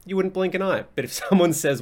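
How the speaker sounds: usual speaking voice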